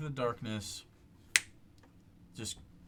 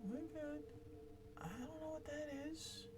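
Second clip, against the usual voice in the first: much higher, softer put-on character voice; hidden NPC voice; 11 dB quieter than usual. Unseen companion answering the whisper in a high soft voice. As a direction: answer from the dark in a high small voice.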